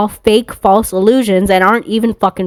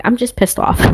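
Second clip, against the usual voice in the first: usual speaking voice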